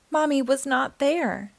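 ordinary delivery

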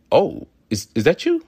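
"is that you" pitched high and rising, an innocent question; pure question. Shown as a demonstration. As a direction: ask "is that you" unknowingly, voice climbing on "you". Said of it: "Pure, pure question".

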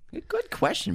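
a mocking falsetto compliment; squeaky mock praise. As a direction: jump way up high on good, good question. Repeats in high mock voice.

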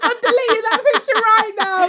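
loud, high laughing protest; half-shouted while laughing. Real, not acted. Embarrassed, laughing.

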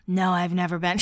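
imitated low, raspy irritation; overdone smoker-like rasp; annoyed raspy voice. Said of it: "a little raspy voice when she's annoyed".

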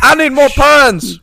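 high, slow, loud hammed-up pitchman plea; pushy car salesman. Mocking a dealer's radio spot, loud pleading pitch. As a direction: beg the listener to come in like a desperate dealer.

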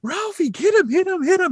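imitated kids, very high-pitched chant; high mock playground cheering; egging on. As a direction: egg on a fight in a squeaky kid voice. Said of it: "just egging him on".

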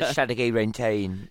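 put-on slow, mangled Northampton accent. Alan mocking Northampton speech in a slow accent. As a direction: garble it like a Northampton local. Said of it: "how they talk in Northampton".

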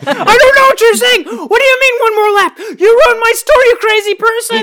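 high, loud outraged shopkeeper voice; angry shopkeeper. Acted. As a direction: shopkeeper yelling at the driver who wrecked his store, outraged. Shopkeeper shouting back at Dak.

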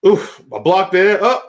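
high, rising live match call; excited play-by-play. Real, not acted. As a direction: build up the call to a shout. Calls the roll-up live.

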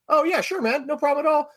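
high-pitched, easygoing agreeable guy; Jon Hamm. Retold or imitated. Star accepts a beer from a fan, breezily agreeable.

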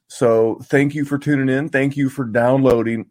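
normal talking voice

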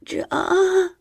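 high little mew. Acted. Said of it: "I mewed".

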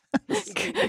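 high giggle. Real, not acted. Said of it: "Great move, dude".

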